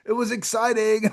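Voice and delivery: high-pitched, giddy gushing in disbelief; put-on giddy squeal of excitement. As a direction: imitate a thrilled fan who can hardly believe it. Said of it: "just giddy".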